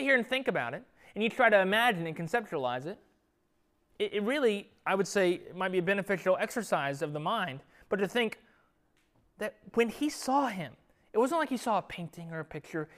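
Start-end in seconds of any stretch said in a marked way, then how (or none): none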